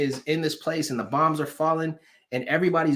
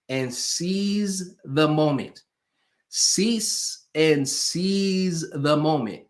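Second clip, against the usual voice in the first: "seize the moment" stretched out word by word; slow emphatic catchphrase; much slower than usual. Shown as a demonstration. Coach teaching clients his motto, "seize the moment" slow and emphatic. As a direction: draw out "seize" and land "the moment" falling. Slogan drawn out for the audience.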